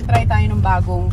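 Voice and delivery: ordinary delivery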